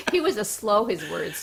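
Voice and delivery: laughing. Real, not acted. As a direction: ride a laugh through the words. Sister laughing, adding to joke.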